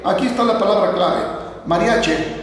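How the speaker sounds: ordinary talk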